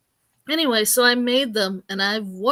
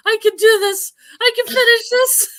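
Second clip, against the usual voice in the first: high, loud, strained mimicry of a kid; mock a tearful twelve-year-old straining to cope; imitated choking kid; 11 semitones higher, 9 dB louder than usual. Imitating a kid eating spicy meatballs, high choked-up cry. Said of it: "starting to cheer up as he's choking".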